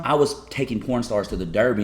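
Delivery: ordinary delivery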